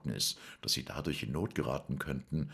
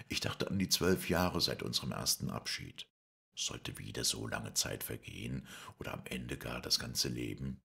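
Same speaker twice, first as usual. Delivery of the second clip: plain reading voice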